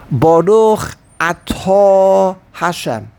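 slow, with "Atah" stretched on its second syllable, a-TAAH; end-stressed Atah. Shown as a demonstration. Rabbi teaching the forward accent, slow, end-stressed "Atah". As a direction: draw out the last syllable of "Atah". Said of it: "it's called Milah Ra".

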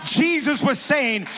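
rally the congregation; loud, drawn-out pulpit build; fervent preaching. Real, not acted. Preaching at full force.

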